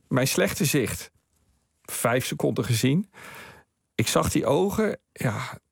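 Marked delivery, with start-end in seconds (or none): 5.1-5.6 soft, hushed yes; moved whisper. Real, not acted. Seeing the photo of the woman again, hushed and moved. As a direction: breathe the yes, deeply moved.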